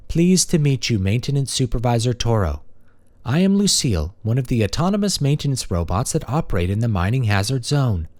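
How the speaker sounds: a courteous maintenance machine; friendly robot. Acted. Robot character speaking.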